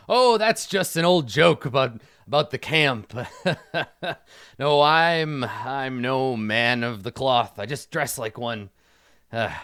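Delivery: put-on chuckling old joker, loud then settling; hearty, self-amused character voice; jovial old camp doctor. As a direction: laugh off your own old joke.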